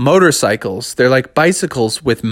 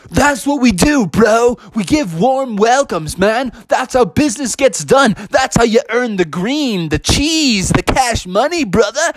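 high, swaggering hype-bro voice; bro-talking employee; 9 semitones higher than usual. Acted. Employee bragging to the bears about business, cocky bro voice. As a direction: boast like a hyped-up salesman bro. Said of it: "And they said".

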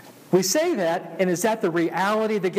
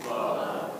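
whispered; 7 dB quieter than usual. Acted. Whispered answer in hymn.

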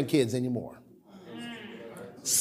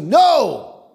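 No shouted high and loud; emphatic shout; 7 semitones higher, 5 dB louder than usual. Real, not acted. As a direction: cut the wrong idea off with a shout. Pastor rebukes a wrong conclusion.

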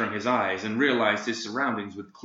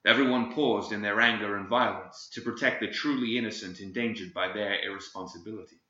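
ordinary delivery, nothing marked

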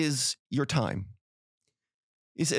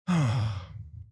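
long falling sigh; audible sigh; 5 semitones lower than usual. Acted. Partner sighing over their email, audible sigh. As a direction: heave a sigh meant to be heard. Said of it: "sighs audibly and they go".

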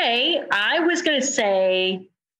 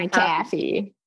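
mock Hoosier accent, "coffee" as "caffee"; teasing copy of a Hoosier accent; imitated Hoosier accent; slower than usual. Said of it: "I have a Hoosier accent".

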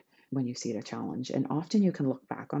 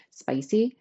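high, louder playful spicy; teasing; 8 semitones higher, 7 dB louder than usual. Real, not acted. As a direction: tease with a knowing lilt. Teasing about rude customer.

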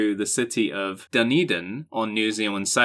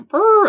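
elephant noise; 8 dB louder than usual. Retold or imitated. Sitcom noise in the kitchen.

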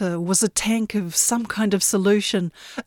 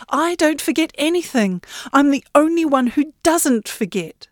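higher, insistent protest; indignant young Yenny; 6 semitones higher than usual. Acted. Yenny insisting to the great ship, indignant. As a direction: push back, stung and proud.

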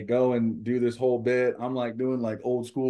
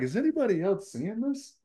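very high, incredulous mock question; high-pitched amazed query; retold disbelief; 12 semitones higher than usual. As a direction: imitate someone tickled and baffled. Retells manager's amused disbelief.

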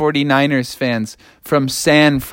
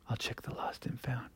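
whispered, nearly silent aside; 4 semitones lower, 25 dB quieter than usual. Acted. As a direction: breathe it out without voice. Repeated line whispered.

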